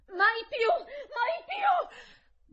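high, piercing shout; desperate screech; strident cry. A narrator's reading. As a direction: cry out shrilly at the top of the voice. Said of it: "gridai con voce stridula".

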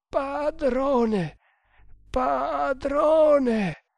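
long, high wail for the master; gasping cry. A narrator's reading. Dying runner calls to his master, gasping cry. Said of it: "rantolò l'indiano".

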